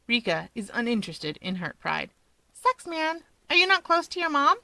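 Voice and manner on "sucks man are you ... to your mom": put-on high bright girl voice; higher pitched female character; young woman voice. A narrator's reading. Rika's voice, high.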